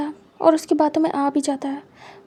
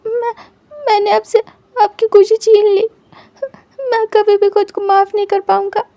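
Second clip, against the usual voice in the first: crying guilt; 6 semitones higher, 7 dB louder than usual. Acted. Blaming himself for the loss.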